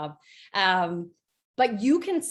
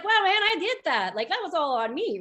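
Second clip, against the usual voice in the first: much higher, thrilled self-praise; light up like you just saw the numbers; proud amazement; 9 semitones higher than usual. Retold or imitated. Seeing her Friday pay totals, much higher, delighted pride. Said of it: "'cause I was like".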